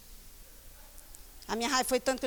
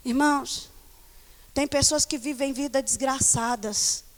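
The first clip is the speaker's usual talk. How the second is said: ordinary delivery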